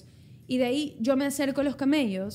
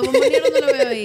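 loud, laughing parody of his friend's line; mocking imitation; 9 dB louder than usual. Friend mimics the host's line, laughing loudly. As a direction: throw his words back at him, cracking up. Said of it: "si hacemos la imitación".

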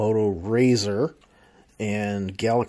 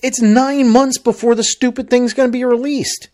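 exasperated rant; 10 semitones higher, 5 dB louder than usual. Real, not acted. Complaining rant about renders.

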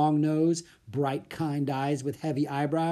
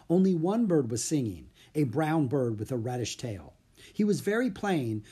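plain reading voice

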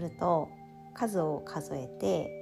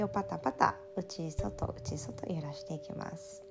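soft spa whisper; 3 dB quieter than usual. Acted. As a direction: whisper gently like a spa therapist. Whispered spa-style guidance.

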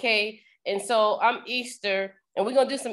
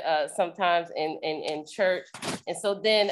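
ordinary talk

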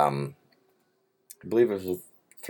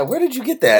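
high teasing question; 4 dB louder than usual. Real, not acted. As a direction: pitch it up, playfully accusing. Teasing question about the tag.

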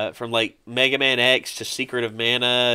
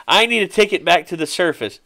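higher-pitched cartoon request; SpongeBob; 6 semitones higher, 4 dB louder than usual. Fan retelling SpongeBob asking at the bus counter, higher voice. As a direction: lift the pitch into a light character voice. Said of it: "SpongeBob was just like".

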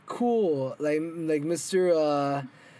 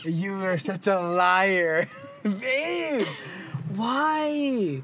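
drawn-out, rising complaint; playful protest; 3 semitones higher, much slower than usual. Real, not acted. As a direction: whine teasingly at your partner. Playful protest at partner's secret purchase.

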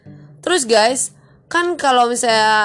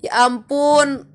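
drawn-out, higher-pitched exclamation of disbelief; mock disbelief; 5 semitones higher, much slower than usual. Speaker reacts to someone's total turnaround, in drawn-out mock disbelief. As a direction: stretch it out in mock amazement. Acted reaction to the sudden change.

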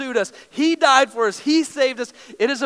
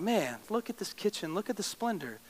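much softer, savouring admiration; hushed awe; 2 semitones lower, 8 dB quieter than usual. Retold or imitated. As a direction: gaze at it in quiet wonder. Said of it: "And I was like".

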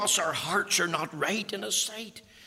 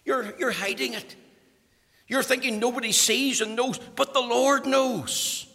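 loud, high preaching build; preaching at full force; 7 semitones higher, 6 dB louder than usual. Acted. Preacher thundering at the congregation, loud and high. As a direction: drive the point home to the congregation.

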